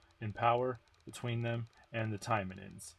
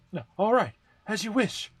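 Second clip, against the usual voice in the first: higher, louder cheerful agreement; happy voice; 11 semitones higher, 9 dB louder than usual. Acted. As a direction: answer gladly, relieved. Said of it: "Right after the happy voice".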